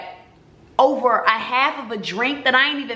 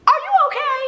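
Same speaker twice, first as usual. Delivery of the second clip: squeaky high incredulous "are you okay"; mock disbelief, pitched way up; high, strained, exasperated question. Exasperated retelling of bad date.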